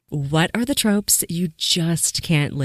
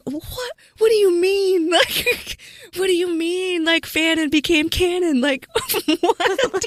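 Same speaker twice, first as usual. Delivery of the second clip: incredulous laughing disbelief. Retold or imitated. Fan hearing fanon became canon, high incredulous laughter. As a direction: shriek of disbelief breaking into laughter. Retold reaction to fanon news.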